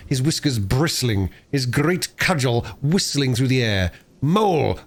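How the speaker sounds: plain reading voice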